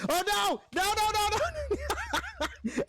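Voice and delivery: real alarm as things go wrong; frantic rising no no no; panicked no. Real, not acted. Disaster in game.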